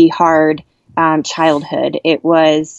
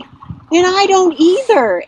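high, slow, drawn-out happy cry; ecstatic cry; 13 semitones higher, slower than usual. Retold or imitated. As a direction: let the joy lift the voice up high. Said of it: "I was like".

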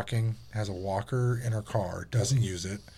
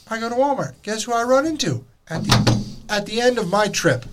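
higher and louder buildup; excited reveal; 10 semitones higher, 6 dB louder than usual. Real, not acted. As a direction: build to the punchline.

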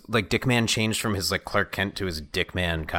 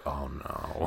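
sinking realization; 8 semitones lower, 12 dB quieter than usual. Retold or imitated.

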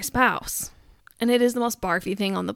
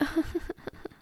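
soft, higher mock giggling; suppressed giggle; 6 semitones higher, 10 dB quieter than usual. Wife pranking her husband, trying not to laugh. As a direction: imitate a badly stifled laugh. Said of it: "she's, like, obviously laughing".

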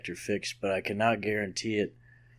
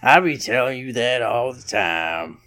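hammed-up Louisiana scolding, slowing at the end; Louisiana guy voice. Podcaster teasing his co-host, Louisiana guy voice. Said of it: "get you in trouble for doing that voice".

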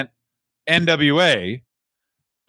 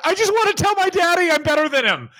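hammed-up, very high tearful pleading; mock-crying wrestler in a high voice; crying daddy's boy; 11 semitones higher than usual. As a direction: wail like an overgrown child begging for approval. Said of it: "crying all the time about their daddies".

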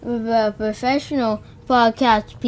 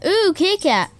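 talking to the cat; 7 semitones higher than usual. Real, not acted. Greeting the cat in the room.

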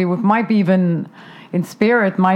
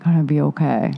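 low, hushed reassurance; soft, understated and near a whisper; imitating straight-faced calm; 4 semitones lower, 4 dB quieter than usual. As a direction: mimic someone quietly unruffled. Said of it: "really do have a straight face".

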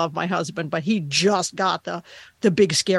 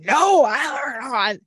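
a mock stern dad no, louder and higher; big angry dad voice; 5 semitones higher, 4 dB louder than usual. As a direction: imitate a husband scolding the puppy with big anger. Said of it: "he does the big angry".

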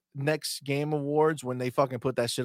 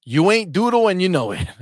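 higher, louder mock reading; reading chat aloud hyped; 7 semitones higher, 8 dB louder than usual. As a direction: belt out the viewer's message with glee. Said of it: "He says".

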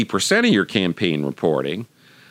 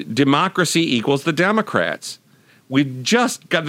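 ordinary delivery

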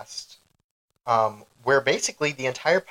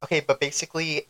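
ordinary talk

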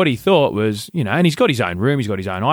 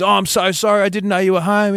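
higher-pitched imitation of a caught son; mock panicked apology; 8 semitones higher than usual. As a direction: plead as a brother caught red-handed. Caught brother apologises to mum.

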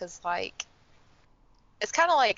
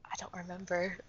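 whispering; 8 dB quieter than usual. Real, not acted. Whispered aside.

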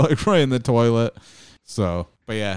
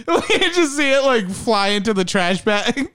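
laughing; 14 semitones higher than usual. Real, not acted. Giggling high while telling.

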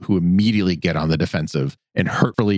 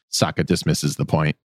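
normal talking voice

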